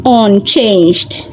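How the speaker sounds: plain reading voice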